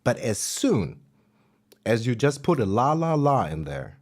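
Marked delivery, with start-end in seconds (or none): none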